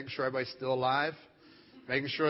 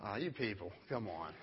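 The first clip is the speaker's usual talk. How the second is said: low, soft, weary exasperation; understated, deep-pitched disbelief; exasperated dad; 8 semitones lower, 11 dB quieter than usual. Retold or imitated. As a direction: grumble it quietly, fed up with them. Fed up with screaming family.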